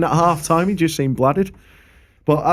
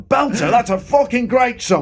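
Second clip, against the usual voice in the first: mock drunken shout; 11 semitones higher than usual. Drunk heckler retold, shouted.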